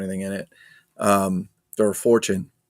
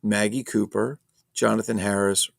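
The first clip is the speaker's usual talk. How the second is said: ordinary talk, nothing marked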